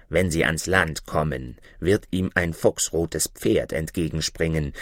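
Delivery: prophesy doom like a dark bird; ominous raven. Acted.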